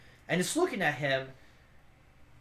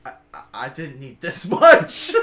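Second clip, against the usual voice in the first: louder, stammering through laughter; laughing; 2 semitones lower, 9 dB louder than usual. Acted. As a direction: laugh while you protest. Shepard receives whole horn.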